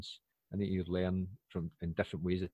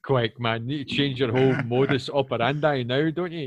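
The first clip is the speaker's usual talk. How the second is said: mock alarm; 3 semitones higher, 9 dB louder than usual. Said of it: "I was like".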